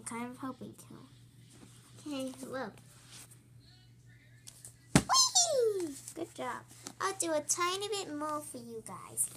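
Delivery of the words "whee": high, loud gleeful squeal; excited whee. Real, not acted. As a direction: squeal with joy mid-flip. Child flipping for camera.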